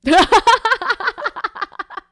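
real laugh, loud and bright. Real, not acted. Cohost cracking up at the Your Grace plan. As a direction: burst of loud high laughter.

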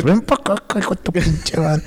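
mock angry mother, high and sharp; furious mom. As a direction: bark it like an enraged mother calling her kid over. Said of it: "cuando te atruenan los dedos y pelan los dientes".

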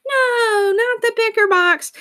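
high, crying wail of protest; whiny dramatic kids. Retold or imitated. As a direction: wail it like a dismayed child. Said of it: "they look at me and they say".

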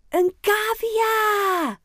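high, loud, long-drawn cheer; children's excited shout. A narrator's reading. Children seeing the guinea pig, excited drawn-out shout. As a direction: shriek it with delight, stretching the last word. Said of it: "roepen de kinderen".

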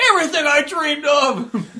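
high, slow, laughing swoon; mock rapture. The hosts' pretend henchman swooning over the ruins, high laughing gush. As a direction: gush it like a dream come true, giggling. Continuing comic bit.

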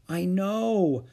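ordinary delivery

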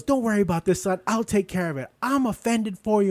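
lightly voiced fussy lady, playing it up. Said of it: "And she goes".

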